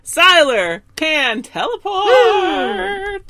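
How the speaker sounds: co-host crying out the big theory, excited; excited exclaim. Real, not acted. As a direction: announce the theory like a win. Gleeful theory reveal.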